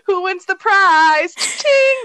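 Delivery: high, loud hammed-up host voice with a ching; mock game show host. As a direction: announce the prize like a cheesy game show.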